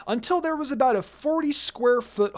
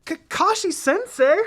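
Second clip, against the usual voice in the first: high, louder whining protest; embarrassed whine; 9 semitones higher, 5 dB louder than usual. Acted. Hinata whining at her teasing teacher, high and loud. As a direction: whine his name in embarrassment. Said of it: "she whined".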